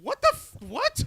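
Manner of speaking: high, loud disbelief; astonished outburst; incredulous. Real, not acted. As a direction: blurt it out, cut short, baffled. Startled that teams have coaches.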